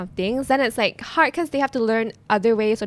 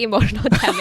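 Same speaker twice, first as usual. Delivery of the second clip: louder, amused imitation breaking into laughter; laughing catchphrase; 2 semitones higher, 6 dB louder, faster than usual. Guest quoting strangers who recognise her on the street, laughing catchphrase. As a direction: quote it through a laugh.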